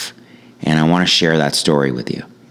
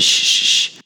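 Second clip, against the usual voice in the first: shushing. Retold or imitated. Kid in back seat shushing the others, hissed shh. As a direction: hush the rowdy kids. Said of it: "starts shushing all the other kids".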